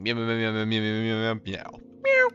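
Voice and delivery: lightly voiced meowing, fading; lazy little meows trailing off; cat meows. Reading more meows.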